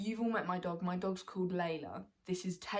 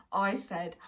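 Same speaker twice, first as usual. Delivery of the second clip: normal talking voice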